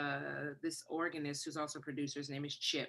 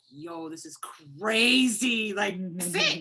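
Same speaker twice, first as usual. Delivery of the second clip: loud, high retold cheering, words stretched out; building from amazed drawn-out delight to a high shout; excited celebration; 4 semitones higher, 9 dB louder, much slower than usual. Sister celebrates her sibling being signed, excited.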